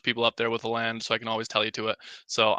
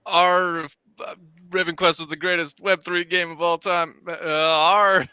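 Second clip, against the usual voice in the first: lightly voiced pirate, louder; half-hearted pirate pitch, higher; 5 semitones higher, 5 dB louder than usual. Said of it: "Show Raven Quest in your best pirate voice".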